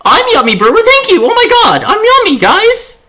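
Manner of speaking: very high, loud delighted squealing; real fangirl shriek, sky-high and loud; excited squeal. Real, not acted. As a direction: squeal with joy at a compliment. Squealing over a viewer's compliment.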